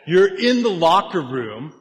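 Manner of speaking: high, loud, revved-up preaching; fired-up coach. Acted. Preacher firing up the congregation, loud and rising. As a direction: rile the room up like a coach.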